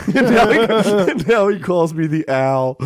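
laughing while quoting Kamaru; bursting with laughter through the words. Retold or imitated.

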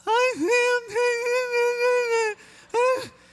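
very high, loud, strangled sobbing squeak; hammed-up blubbering through wedding vows; mock tearful squeal. Groom repeating vows to his bride, comically overcome with tears. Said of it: "And this is what happens".

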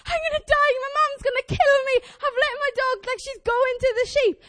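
high, loud, frantic retold wail; mock panic. Owner watching her dog run at sheep, panicked.

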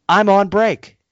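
high, loud mock yell from the back; retold yell. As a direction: call out an offhand yell. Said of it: "someone yells from the back".

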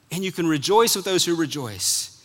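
ordinary delivery

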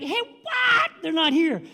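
loud, high yelp of surprise; mock bafflement. Soldiers finding the Israelites gone, mock bafflement. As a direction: exclaim it in hammed-up disbelief.

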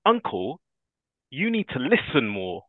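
imitating a huffy, puffy niece scolding her uncle; huffy little girl. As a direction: pout it like a cross little girl. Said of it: "in a very sort of a huffy, puffy sort of way".

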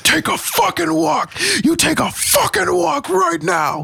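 high, slowed yelling right in his face; furious shout. Retold or imitated. Retelling screaming at the drunk guy trying to open the car door. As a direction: scream at him to take a walk. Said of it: "never yelled at somebody like this".

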